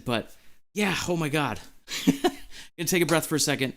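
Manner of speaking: ordinary talk, nothing marked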